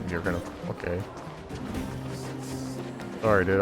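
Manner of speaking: normal talking voice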